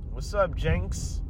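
ordinary talk, nothing marked

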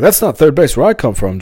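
loud, high indignant objection; mock-outraged correction; George. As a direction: object like an exasperated sitcom friend. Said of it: "George said that".

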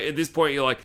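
normal talking voice

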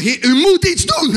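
high, loud, slow appeal; desperate plea. Retold or imitated. Addict at breaking point begs the Lord, desperate plea. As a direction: cry out as if at the end of your rope. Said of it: "ik was op het punt".

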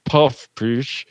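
slow, mush-mouthed mumbling impression. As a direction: drag the words out through a stuffed mouth. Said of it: "he has, like, this mumble".

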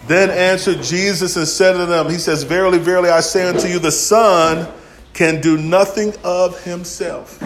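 scripture reading. Acted.

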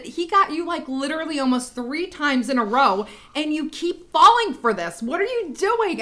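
high, incredulous telling-off; retold mock dressing-down, pitched well up; exasperated scolding. Aunt scolding Vin from the back seat, exasperated.

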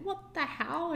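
high-pitched stunned exclamation; disbelieving what the hell. Retold or imitated. Mother baffled by the three-days-before offer, high shock. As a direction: let the shock jump up in pitch.

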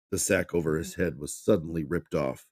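ordinary delivery, nothing marked

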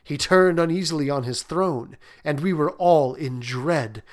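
ordinary delivery, nothing marked

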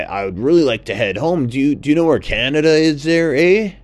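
put-on a polite, flustered lost Canadian goose, Canadian accent; lost Canadian goose explains himself to the toucan, Canadian accent. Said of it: "the Canadian goose, said".

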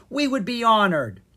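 high, loud, grateful exclamation; delighted cry. A narrator's reading. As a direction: gush to the princess. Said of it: "Miranda cried".

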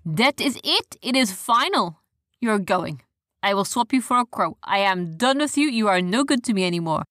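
exasperated captain's voice, starting high; lost temper. A narrator's reading. Captain fires his useless parrot, losing his temper. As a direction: scold the bird in a put-on captain voice. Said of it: "lost his temper with the parrot".

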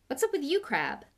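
high start, dropping on the name; snappish. A narrator's reading. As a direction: snap at a friend in irritation. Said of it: "snapped Malfoy".

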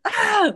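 sharp high startled yelp. Retold or imitated. A startled little yelp, retelling a reaction. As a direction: yelp like something made you jump.

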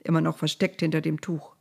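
plain reading voice

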